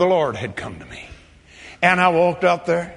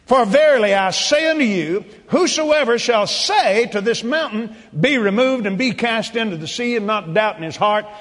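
raised, solemn preaching read; scripture reading; 4 semitones higher than usual. Acted. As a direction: proclaim the verse like a preacher. Reads scripture aloud.

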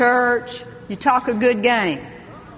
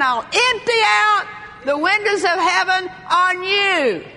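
God proclaiming; 6 semitones higher than usual. Acted. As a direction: proclaim it grandly as God's own promise. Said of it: "say, God saying".

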